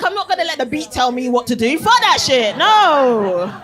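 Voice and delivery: loud, laughing defiance; mock carefree dancer. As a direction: shake off the beat with cheeky defiance. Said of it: "You're just like".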